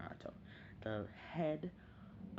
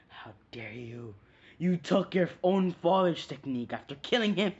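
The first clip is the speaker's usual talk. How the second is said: building to a loud, higher shout; outraged accusation; 6 semitones higher, 10 dB louder than usual. Retold or imitated. Clan accusing Naruto of killing his father, outraged. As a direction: accuse him in fury. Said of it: "and he's like".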